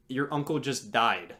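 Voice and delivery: ordinary talk, nothing marked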